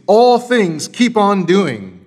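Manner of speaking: "All" hit high and loud at the front; slow, emphatic line, landing hard on "All"; stress on ALL. Shown as a demonstration. Preacher showing a fronted Greek word, "All" strongly emphatic. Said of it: "an emphasis on the word all".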